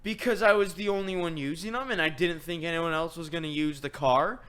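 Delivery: high, loud retold self-defence; exasperated defence. Son justifying leaving keys in the car, exasperated.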